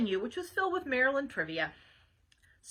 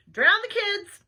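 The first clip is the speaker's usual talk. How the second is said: high, loud waitress holler; short order yelled out; 8 semitones higher, 9 dB louder, slower than usual. Acted. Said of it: "hollered the waitress".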